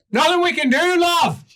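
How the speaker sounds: high, loud guard calling down; guard on the wall refusing the party, high and loud; cheeky guard. Acted. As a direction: shout it down cheerfully from the wall. GM voices the guard.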